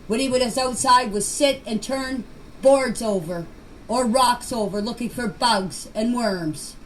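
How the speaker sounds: ordinary talk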